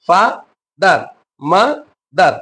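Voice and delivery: "ফা, দার, মা, দার" in detached beats; syllable by syllable. Shown as a demonstration. Teacher dividing words in two, "ফা, দার, মা, দার" syllable by syllable. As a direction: pause between "ফা" and "দার", "মা" and "দার". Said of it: "দুই ভাগে বিভক্ত করে উচ্চারণ".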